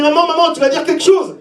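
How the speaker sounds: high-pitched pleading to mum; traumatised child. Retold or imitated. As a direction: imitate a shocked kid appealing to his mother. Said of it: "j'étais traumatisé. J'ai dit".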